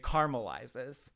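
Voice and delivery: "caramelizes" as CAR-mul-izes; dropped second a. Shown as a demonstration. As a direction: drop the middle a of "caramelizes". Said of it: "Yeah, I drop it".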